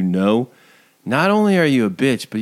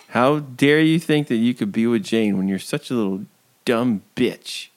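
ordinary delivery

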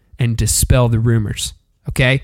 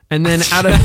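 ordinary delivery

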